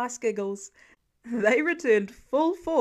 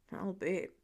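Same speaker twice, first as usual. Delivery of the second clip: soft, dry mutter under the breath; 5 semitones lower, 7 dB quieter than usual. A narrator's reading. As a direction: say it half to yourself. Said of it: "Clint muttered".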